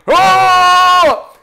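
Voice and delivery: long, loud answering war cry; sustained high shout; battle yell. Acted. Philistines yelling back across the valley, loud war cry. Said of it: "and they're yelling".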